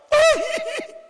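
very high hammed-up wail; piercingly high pretend sob; mock wail. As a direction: wail in exaggerated fake weeping. Imitates crying members wailing.

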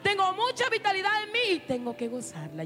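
high, insistent preacher's retelling; protesta juvenil exaltada. Retold or imitated. Acts own youthful protest, preaching. (protesta exaltada, aguda e insistente)

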